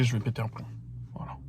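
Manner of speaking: quiet unvoiced whisper. Real, not acted. Telling viewers he will lose it, in a quiet whisper. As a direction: hiss it under his breath. Stressed about food and keys.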